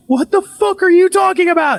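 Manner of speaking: high-pitched imitated panic; freaked out. Wife told about the name, freaked out. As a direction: voice her shrieky disbelief.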